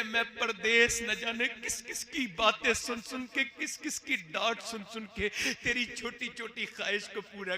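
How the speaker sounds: loud impassioned fatherly plea; father's emotional plea. Acted. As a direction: plead with the son for respect.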